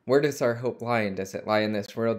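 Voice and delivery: usual speaking voice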